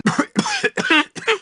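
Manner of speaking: high choking cough; disgusted coughing fit. Acted. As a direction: cough from the stink. Coughing at a foul odor.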